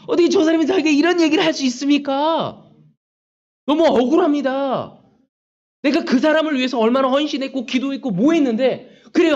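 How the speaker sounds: wronged and fuming, half laughing at the injustice; angry indignant prayer. Retold or imitated. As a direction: vent the hurt to God, voice rising. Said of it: "너무나 마음이 화가 나고 분하고".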